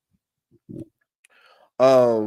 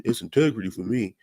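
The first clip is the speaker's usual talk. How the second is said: soft, lower mumbling impression of Damon; lightly mocking Damon going on about integrity; imitating Damon; 3 semitones lower, 4 dB quieter than usual. Damon defends himself to the group, soft mumbled mock. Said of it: "And Damon was like".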